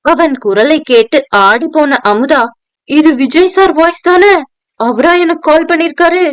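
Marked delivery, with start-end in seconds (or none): 2.9-6.3 higher, amazed inner question; can't believe it's him; disbelief. A narrator's reading. Amudha shocked hearing Vijay on the line, amazed. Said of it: "நம்ப முடியாமல் தனக்குள்".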